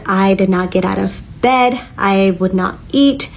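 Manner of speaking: ordinary talk, nothing marked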